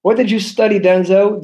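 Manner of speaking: normal talking voice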